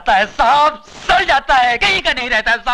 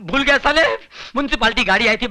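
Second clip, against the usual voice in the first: normal talking voice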